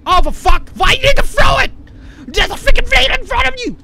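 loud outburst climbing high; exasperated shouting. Real, not acted. Player fuming at a needless throw, exasperated high shouting. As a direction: shout it, voice shooting up in frustration.

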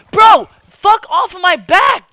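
high, loud angry yell; furious shout. Real, not acted. Streamer screams at a teammate crowding him in the game. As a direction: blast the teammate off your back.

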